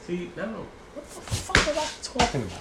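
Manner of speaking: high, exasperated outburst; fed up with losing the thread; frustrated. Real, not acted. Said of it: "That just made me mad".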